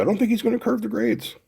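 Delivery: Blake's dry worry, voiced up high; mock rueful Blake. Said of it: "So Blake said".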